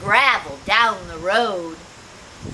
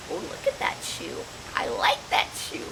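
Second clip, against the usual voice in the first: whispered and much softer; hushed whisper; 6 semitones higher, 7 dB quieter than usual. Real, not acted. Pointing out the puffed-up toad to children, whispered. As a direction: lean in and whisper it.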